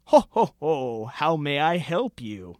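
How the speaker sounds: lightly voiced Santa Claus. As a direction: boom out a jolly greeting. Santa's voice in the poem.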